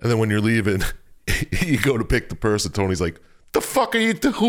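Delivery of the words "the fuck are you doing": high-pitched mock outraged Tony; retold indignant challenge, pitched way up; mock outrage. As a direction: act out an incredulous telling-off, half laughing. Tony catches player taking purse.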